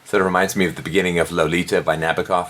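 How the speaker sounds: usual speaking voice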